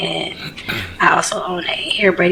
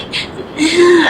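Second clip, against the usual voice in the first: mock bratty groan; 10 semitones higher than usual. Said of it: "She was like".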